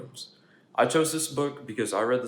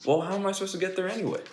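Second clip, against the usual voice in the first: fast, higher pigeon question; quick pigeon; 8 semitones higher, faster than usual. Acted. Pigeon asks how to get to school, fast and higher. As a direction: rush the question.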